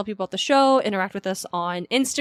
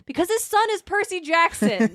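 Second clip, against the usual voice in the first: put-on excited kid; 8 semitones higher than usual. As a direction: imitate a child in a high-pitched voice. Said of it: "And the kid's quiet. He's like".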